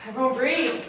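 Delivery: ordinary delivery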